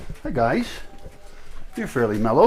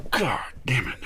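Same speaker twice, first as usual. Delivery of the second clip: pained whispered curse; 11 dB quieter than usual. Real, not acted. Pained curse under breath.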